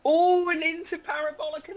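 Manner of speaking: high, lifted commentary call; excited play-by-play. Real, not acted. Commentator calling the cars into Parabolica, excited. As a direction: swoop up high as the cars turn in. Live commentary on close racing.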